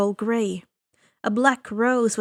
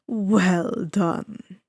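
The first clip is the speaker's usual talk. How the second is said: slow, low, smooth villain voice; suave ferryman; 4 semitones lower than usual. Acted. Ferryman of the dead greeting the boy, slow and silky. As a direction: savor each word like a sly host. Charon praising.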